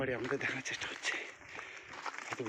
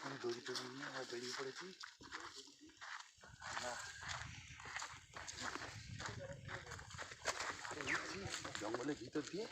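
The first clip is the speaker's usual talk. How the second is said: much softer, hushed narration; 11 dB quieter than usual. Real, not acted. To viewers in the jungle, hushed so birds don't fly. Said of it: "ওই জন্য আস্তে আস্তে কথা বলছি".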